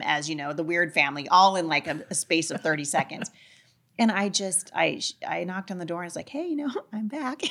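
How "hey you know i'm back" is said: high voice breaking into a laugh; re-enacted sheepish hello, pitched up; sheepish return. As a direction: laugh through an awkward re-entrance. Retold sheepish return to frightened kids.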